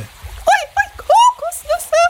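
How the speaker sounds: sehr hohe, quietschige Kuscheltierstimme; freudig quiekend. Acted. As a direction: begeistert hoch und piepsig. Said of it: "freut sich Swinguru". (hohe, freudige Figurenstimme)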